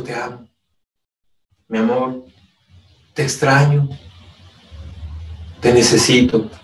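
tender love words. Pretend. Husband telling wife he loves her.

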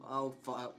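ordinary talk, nothing marked

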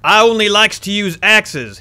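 loud put-on gruff voice for the book's character. Reading the Axe Man's note aloud, loud gruff voice. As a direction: read it like the hulking axe man.

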